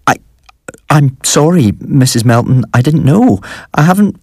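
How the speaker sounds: high, halting apology, climbing in pitch; flustered stammering. A narrator's reading. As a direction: stammer and let the pitch rise.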